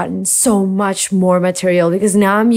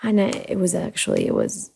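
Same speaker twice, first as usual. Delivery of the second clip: ordinary talk, nothing marked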